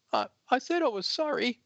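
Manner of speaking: high, small apologetic voice; timid girl voice. Acted. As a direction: shrink back and apologize. Sarah's meek apology in comic.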